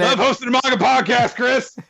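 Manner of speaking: high, loud sarcastic outburst; hammed-up high-pitched holler; mock enthusiasm. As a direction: shout it with overdone cheer at your co-host.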